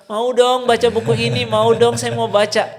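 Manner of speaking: high, loud mock begging; eager clamouring. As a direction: play an excited crowd pleading for a turn. Acting out an imagined eager crowd.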